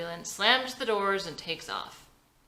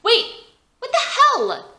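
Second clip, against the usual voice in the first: high, loud, shocked cry; jolted awake and indignant; wife realizing she is being sent away, shocked shout; startled outburst; 13 dB louder, slower than usual. Acted. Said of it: "Vicky snaps out of it".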